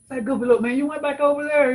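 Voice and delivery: high, mocking teen voice scolding; imitating the kid. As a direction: pitch way up to play the teen. Retold kid scolding the speaker.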